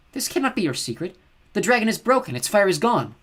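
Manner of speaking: high, scornful goddess voice; cold scornful snow goddess; icy goddess. Acted. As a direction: sneer it down at her as a cold goddess. Chione voiced in audiobook.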